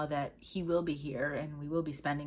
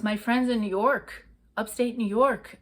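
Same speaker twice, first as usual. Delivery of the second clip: loud, higher, drawn-out New York accent; unintended New York accent; 6 semitones higher, 7 dB louder than usual. Real, not acted. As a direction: slip into a big, slow New York accent. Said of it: "giving that New York type of co-, accent".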